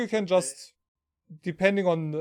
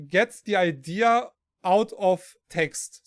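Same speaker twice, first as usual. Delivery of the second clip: usual speaking voice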